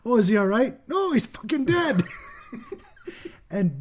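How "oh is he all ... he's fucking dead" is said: high put-on character voices; mock-exasperated back-and-forth for laughs; hammed-up comic exchange. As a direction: question pitched up, answer higher still. Acts comic exchange over exploded head.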